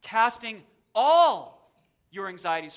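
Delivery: "all" hit loud and drawn out; slow down and boom the word "all"; stress on all. Shown as a demonstration. Preacher reading the verse for different emphasis, heavy stress on "all". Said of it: "I like to emphasize different words".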